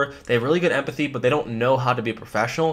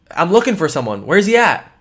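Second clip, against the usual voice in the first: louder, pushy search for someone; urgent demand; 4 semitones higher, 7 dB louder than usual. Shown as a demonstration. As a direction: demand where he is. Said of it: "is like".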